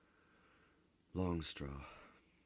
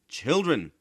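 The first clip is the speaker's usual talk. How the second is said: loud, high shout; 10 semitones higher, 12 dB louder than usual. A narrator's reading. Bodini shouting at his noisy children, loud and high. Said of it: "shouted Bodini".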